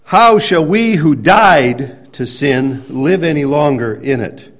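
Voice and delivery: slow, loud declaimed verse; scripture reading. Acted. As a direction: proclaim the verse slowly to the congregation. Reads the verse aloud.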